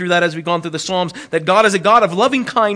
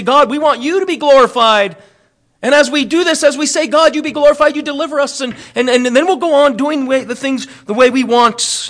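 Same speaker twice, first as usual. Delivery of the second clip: higher, louder mock pleading to God; butter God up with eager, raised voice; mock flattering prayer; 7 semitones higher, 5 dB louder than usual. Voicing someone trying to manipulate God, mock flattering. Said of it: "trying to butter God up".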